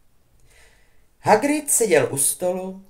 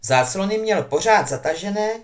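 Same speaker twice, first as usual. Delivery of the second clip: ordinary delivery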